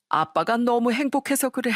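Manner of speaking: a touch of tears in a father's happy voice; tearful happiness. Acted. Father telling his daughter why he cries, mildly tearful. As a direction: say it choked up with joy, understated.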